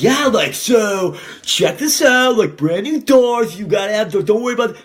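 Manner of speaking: high, eager salesman hype; pushy sales pitch. Pretend. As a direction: oversell the doors with fake enthusiasm.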